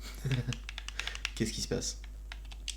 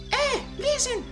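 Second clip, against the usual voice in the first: very high squeaky fairy call; slower than usual. Acted. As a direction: chirp it like a tiny fairy. Navi's catchphrase in fairy voice.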